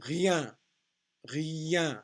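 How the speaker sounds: "Rien" with a Y glide into the nasal vowel; French "Rien", glide sounded clearly; glide before nasal. Shown as a demonstration. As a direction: say "rien" as "ree-yan", no final N. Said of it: "extra sound in these right before the nasal".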